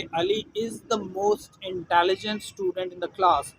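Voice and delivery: very slow example with the stressed; deliberate teacher dictation; slow example read. Shown as a demonstration. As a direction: dictate it slowly, leaning on the. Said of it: "example sentence read slowly".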